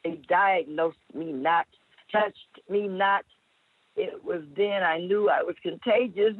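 measured, rhythmic poetry performance; dramatic spoken word delivery; spoken word poem. Acted. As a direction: perform the poem with slow weight.

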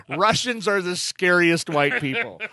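loud, high, through laughter; real laughter in the voice; laughing punchline. Real, not acted. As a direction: deliver the punchline while cracking up.

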